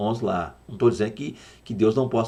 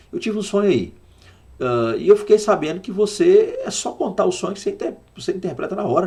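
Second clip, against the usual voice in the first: higher, eager king asking Joseph; raised pitch as the curious Pharaoh; Pharaoh's voice; 7 semitones higher than usual. Acted. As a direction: voice Pharaoh as he asks Joseph for help. Pharaoh voiced in the retold story.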